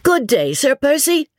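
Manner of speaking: high-pitched, eager greeting; chorus of greeters. A narrator's reading. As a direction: call out a welcome from the crowd. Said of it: "heard in one long-continued chorus".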